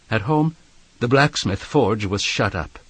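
ordinary delivery

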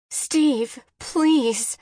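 high, emphatic plea; desperate wife pleading; begging. A narrator's reading. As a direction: implore him, each word pressed out. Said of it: "Jen begged".

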